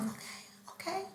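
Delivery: whispered reluctant okay; barely audible mumbled agreement; quiet. Retold or imitated. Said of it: "And it's like".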